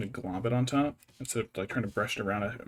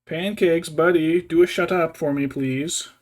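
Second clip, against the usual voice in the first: louder, higher coaxing plea to a noisy cat; raised, half-joking plea to a pet; pleading with the cat; 5 semitones higher, 7 dB louder than usual. Real, not acted.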